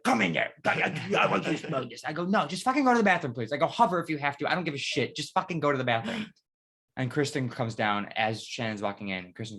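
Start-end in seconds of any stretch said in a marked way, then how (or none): 0.0-2.0 imitating friend. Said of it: "she was like". 2.3-6.3 higher, louder, fast exasperated yelling. Retold or imitated. Yelling at friend to use toilet.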